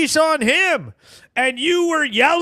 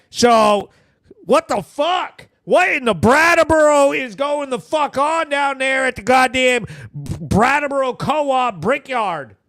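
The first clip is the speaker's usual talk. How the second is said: ordinary delivery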